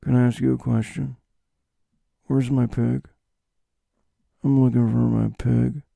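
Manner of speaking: understated, held-back loner impression; Nick Cage. Man asking after his lost pig, understated impression. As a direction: keep the questions even and understated. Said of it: "that understated style of delivering his lines".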